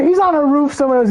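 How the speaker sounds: ordinary delivery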